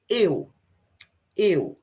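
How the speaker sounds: eu said loud and clear as one glide; pronunciation model. Shown as a demonstration. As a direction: say eu twice, slowly, for the class to copy. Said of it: "how do I pronounce these words?".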